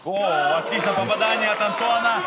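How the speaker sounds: high, loud burst at a clean hit; excited commentary. Real, not acted. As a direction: react with a sudden thrill. (взволнованный комментарий)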